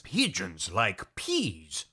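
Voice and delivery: low, very slow expert voice; mock-grave zoologist; Johan, animal expert. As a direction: state it slowly and solemnly, pitched low. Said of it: "calls animal expert Johan, who says".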